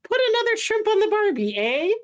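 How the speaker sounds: put-on high-pitched, half-hearted Canadian accent; badly faked Canadian with a tacked-on "eh?"; mock Canadian accent. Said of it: "Can you do a Canadian accent?".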